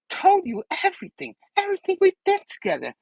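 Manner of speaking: very high, teasing villain voice; Goblin. Acted. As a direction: let it squeak up like a cartoon villain. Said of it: "The Goblin's like".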